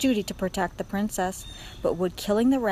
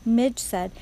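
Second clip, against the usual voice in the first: ordinary delivery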